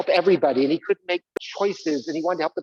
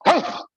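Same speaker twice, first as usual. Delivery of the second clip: dramatic shriek; 12 semitones higher, 5 dB louder than usual. Acted. Sudden dramatic cry.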